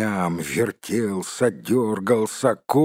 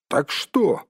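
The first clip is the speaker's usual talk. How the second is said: higher, impatient prompt; 6 semitones higher than usual. A narrator's reading. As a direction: blurt it, unable to wait. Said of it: "не выдержал Бильбо". (нетерпеливо)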